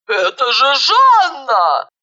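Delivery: high, drawn-out exclamation; exclaiming. A narrator's reading. Old maid recognizing Zhanna at the neighbor's door, exclaiming. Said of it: "воскликнула старая дева".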